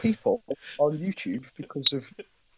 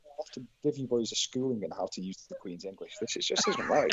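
mock posh schoolmaster; faster than usual. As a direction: lecture the boys in a plummy voice.

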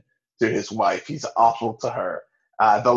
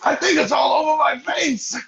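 high shouted panicky Polly; mock Polly shout. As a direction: yelp it like he's covered in itch. Friend imitates Polly's panic.

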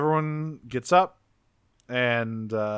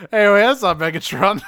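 higher, louder blurt of alarm; mock double take, loud and raised; startled Bulkhead; 6 semitones higher, 6 dB louder than usual. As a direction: call out a startled objection as Bulkhead. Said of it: "immediately Bulkhead's like".

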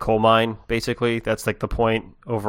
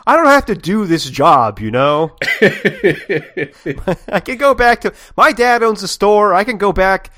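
loud, high mock indignation; huffy Aced; 10 semitones higher, 7 dB louder than usual. Teased man threatens to quit the group, hammed-up indignant. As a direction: play a fed-up worker threatening to walk out, loudly.

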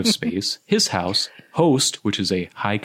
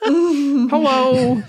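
silly high voice; 4 dB louder than usual. Pretend. Silly voice continues.

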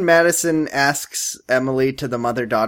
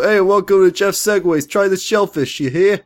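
mock restaurant host; 5 semitones higher than usual. Pretending to be the owner welcoming diners, hammed-up folksy voice. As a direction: greet the customers like a corny diner owner.

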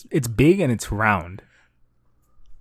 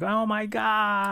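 much higher, softer and slow gasped alarm; mock panic; 10 semitones higher, 6 dB quieter, much slower than usual. Ponzu seeing the snakes come out, high, soft mock panic. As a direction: act scared as the snakes come out.

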